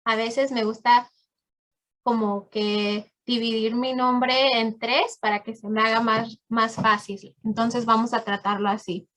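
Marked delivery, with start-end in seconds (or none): none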